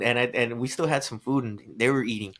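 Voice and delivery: usual speaking voice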